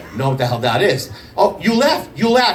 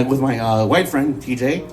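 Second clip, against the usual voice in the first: ordinary delivery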